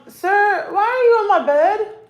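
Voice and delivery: high-pitched mock indignation; playful pet scolding. Real, not acted. Playfully scolding the cat on bed.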